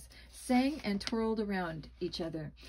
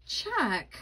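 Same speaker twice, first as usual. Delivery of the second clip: a mild cry of recognition; surprised cry; 4 semitones higher, 4 dB louder than usual. Acted. Boy recognizing Chuck under the mask, a mild surprised cry. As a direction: call the name out in surprise. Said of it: "I cried".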